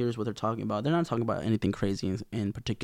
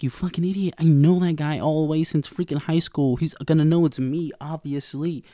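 raised, exasperated scolding; angry outburst; 6 semitones higher, 3 dB louder than usual. Retold or imitated. Misty scolding the girls for picking her friend, exasperated. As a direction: chew them out, higher and pushing.

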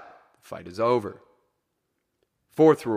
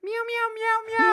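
very high squeaky meowing; high cat meows. Pretend. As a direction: meow like a cat. Joining the meowing bit.

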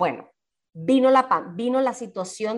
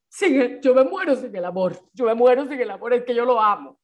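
louder, dramatic lovesick wail; mock lovesick; 2 semitones higher, 4 dB louder than usual. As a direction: ham up dying without love. Mocking lovesick belief.

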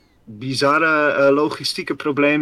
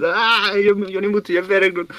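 higher-pitched mock rant in a Russian accent; 6 semitones higher than usual. Podcaster imagining the hot-headed admiral scolding his crew, Russian accent. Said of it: "een Russisch accent".

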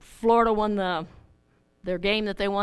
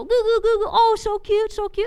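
put-on much higher, cooing at a baby; baby talk; 10 semitones higher than usual. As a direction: coo like a stranger over a pram. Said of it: "then they go".

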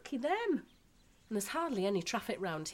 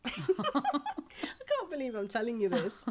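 laughing at her own confession; giggle, then talk through it; embarrassed giggle; 4 semitones higher than usual. Acted. Wife admits a crush to the novelist, embarrassed giggling.

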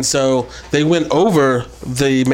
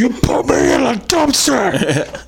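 crying shout; 7 semitones higher, slower than usual. Acted. [name] voiced, crying shout.

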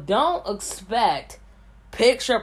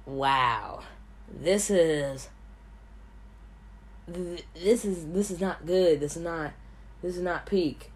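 low, quiet, disappointed critic voice; hammed-up low letdown voice; mocking a critic; 4 semitones lower, 6 dB quieter than usual. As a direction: sneer it like an unimpressed viewer, dropping to near mumble. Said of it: "and you're like".

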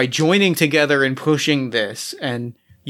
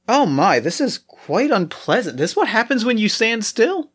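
fussy Sisyphus; 6 semitones higher than usual. Acted. Sisyphus disgusted by blood pool.

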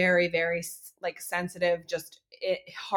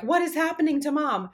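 put-on high, puzzled child voice; worried kid; 10 semitones higher than usual. Kids wondering about crying mom, high and puzzled. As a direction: voice a bewildered little kid.

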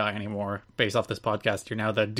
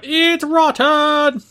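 drawn-out, high, loud outraged shout; full-volume chef's outburst; Gordon Ramsay; 9 dB louder, much slower than usual. Acted. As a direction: bellow it, stretching each word right out. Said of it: "and shout".

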